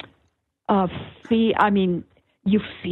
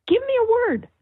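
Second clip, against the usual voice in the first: mock yell; 13 semitones higher than usual. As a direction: call out high, imitating a yell. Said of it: "I would yell out".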